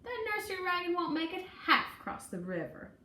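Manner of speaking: fast, high scoffing jeer; quick mocking jeer. A narrator's reading. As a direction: laugh them off the bank. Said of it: "scoffed someone else".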